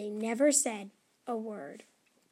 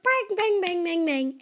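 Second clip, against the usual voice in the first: banging; 6 semitones higher, 4 dB louder than usual. Acted. Big bang of noise.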